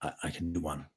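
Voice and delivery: deep, hushed American accent; low, quiet American movie-voice. Shown as a demonstration. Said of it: "this is my American accent".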